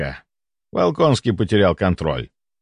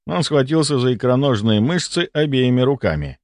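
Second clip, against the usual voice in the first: usual speaking voice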